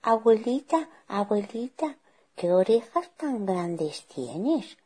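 put-on puzzled little girl. Girl puzzled by granny's looks.

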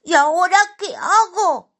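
high, loud panicky question; cartoonish high-pitched dismay; worried little character. A narrator's reading. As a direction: fret in a squeaky little voice. Simón cornered by the dog.

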